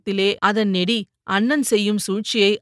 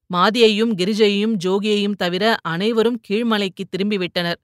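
usual speaking voice

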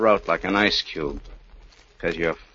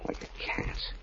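soft, mocking whisper of her name; taunting whisper; 11 dB quieter than usual. Convict whispering a cruel taunt to Kitty. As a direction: whisper it like calling a cat, with menace.

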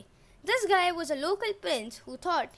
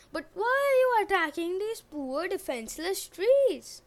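pleading maiden; 6 semitones higher, 3 dB louder than usual. Acted. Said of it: "pleading maiden".

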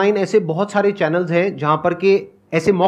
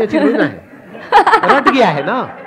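laughing; 4 dB louder than usual. Real, not acted. Laughing at his own rote English.

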